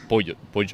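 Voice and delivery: imitating "pollo" with a j sound; imitated pronunciation. Copying co-host's way of saying pollo, imitated j sound. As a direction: try copying "pollo" as "POD-jo". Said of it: "so you say, like".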